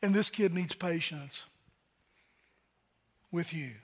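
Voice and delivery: soft, low self-talk, fading away; quiet inner voice. Retold or imitated. Father telling himself to be patient, hushed and low. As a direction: say it to yourself as a hushed realization. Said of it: "all of a sudden I realized".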